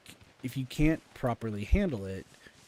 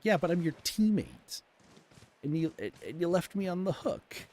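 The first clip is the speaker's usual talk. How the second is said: indignant retort; 7 semitones higher than usual. Retold or imitated. Arguing with teammate in retelling.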